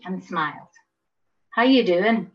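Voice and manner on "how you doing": friendly broad Belfast greeting, a bit louder; a hearty Belfast hello, lightly voiced; broad Belfast accent. A narrator's reading. Patient greets a visitor in a broad Belfast accent. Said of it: "he said in his broad Belfast accent".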